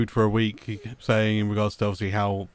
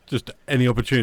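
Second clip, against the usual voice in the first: normal talking voice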